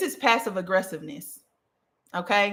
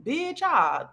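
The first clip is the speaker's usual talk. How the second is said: laughing; 10 semitones higher, 4 dB quieter than usual. Real, not acted. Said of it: "laughing at her own example".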